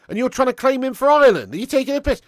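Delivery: high, loud, heated protest; indignant. Real, not acted. Streamer disputing a viewer's claim on players, indignant and loud. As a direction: challenge him in loud disbelief.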